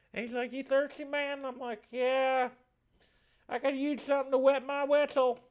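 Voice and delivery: high, excitable Southern spirit with a lisp; effeminate lisping Southerner. Acted. Spirit finishes his tale and bids farewell, effeminate Southern lisp. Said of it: "the effeminate southern man with a lisp".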